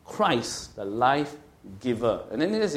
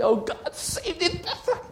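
high, strained mock scream; slow, high-pitched imitation of frantic praying; imitated screaming prayer; slower than usual. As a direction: shriek out a hammed-up prayer. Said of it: "praying and screaming and shouting".

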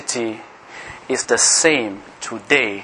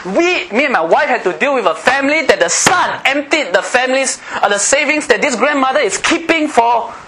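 high-pitched, forceful sermon delivery; driving pulpit challenge in a raised pitch; impassioned preaching; 10 semitones higher, 5 dB louder than usual. Real, not acted. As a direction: preach it hard, pitched well up.

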